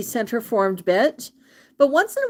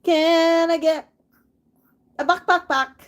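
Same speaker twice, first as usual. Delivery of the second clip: high pet talk; 7 semitones higher than usual. Real, not acted. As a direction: draw the words out for the bird. Coaxing parrot again.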